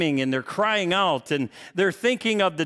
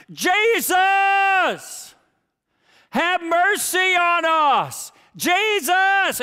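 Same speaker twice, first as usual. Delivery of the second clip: loud, high, drawn-out cry for mercy; desperate yell; 12 semitones higher, 5 dB louder, much slower than usual. Retold or imitated. Lepers yelling to Jesus from the roadside, loud desperate cry. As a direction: cry out from far off, begging. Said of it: "stand back ... and yell".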